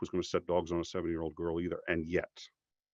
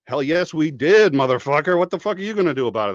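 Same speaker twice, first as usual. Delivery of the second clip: loud, higher, taunting comeback; mock defiant retort; 6 semitones higher, 9 dB louder than usual. Voicing liberals taunting Republicans, loud and gloating.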